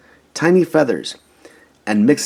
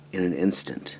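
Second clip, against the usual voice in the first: plain reading voice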